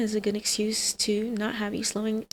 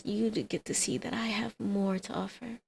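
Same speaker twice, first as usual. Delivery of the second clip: hushed, coaxing whisper; seductive whisper; 6 dB quieter than usual. Acted. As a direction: whisper the offer close to the ear. Seductive offer to ex.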